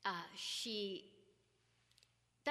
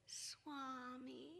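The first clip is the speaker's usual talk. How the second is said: put-on dazed child; 10 dB quieter than usual. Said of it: "she was still in and out of body".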